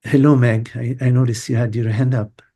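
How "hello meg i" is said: ordinary talk, nothing marked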